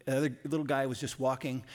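ordinary delivery

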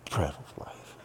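low, whispery mutter of scorn; soft, low mocking grumble; grumbling under breath. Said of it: "they're grumbling underneath their breath".

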